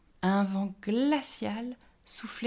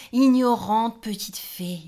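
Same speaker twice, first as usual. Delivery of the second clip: much louder and slower teasing gnome; booming gnome; 4 semitones higher, 11 dB louder, slower than usual. A narrator's reading. The gnome booms his tease at the fairy, slow and loud. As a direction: draw out the tease with a big voice.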